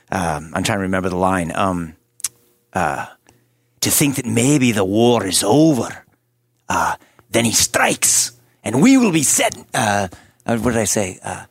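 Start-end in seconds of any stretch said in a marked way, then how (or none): 3.8-9.5 put-on Scottish warrior accent. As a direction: rouse the troops in a Scottish accent, building up. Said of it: "We need to hear the Scottish accent".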